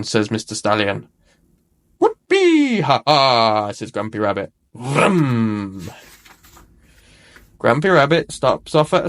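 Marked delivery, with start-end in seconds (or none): 2.0-3.7 a rabbit whooping on his hovercraft; gleeful whoop. A narrator's reading. Rabbit enjoying the ride. 4.7-5.9 a droning hovercraft vroom; playful engine noise for a hovercraft. A narrator's reading. Hovercraft speeds off.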